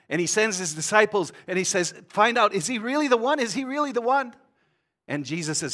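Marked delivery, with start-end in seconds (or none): 2.1-4.3 anxious questioning. Acted. John sends disciples to question Jesus.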